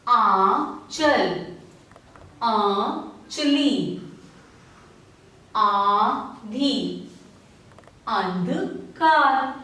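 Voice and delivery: slow, each word set apart by pauses; word list read again. Shown as a demonstration. Teacher repeating the nasal word list for children. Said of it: "एक बार फिर से पढ़ते हैं".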